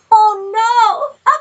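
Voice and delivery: loud, high surprised yelp; a sudden loud "oh no"; startled cry. Real, not acted. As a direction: yelp as the card slips away. A card jumps from the deck.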